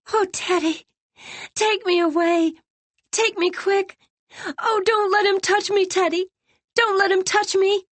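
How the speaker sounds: very high, pleading, frightened voice; sobbing, shaking. A narrator's reading. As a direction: beg to be taken away. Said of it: "Sobbing, shaking".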